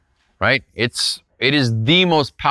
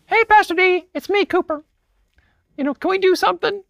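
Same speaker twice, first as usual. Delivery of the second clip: put-on much higher squeaky kid voice, faster at the end; boy's unbroken voice; faster than usual. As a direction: chirp like an eager young boy. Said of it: "His voice hadn't changed yet".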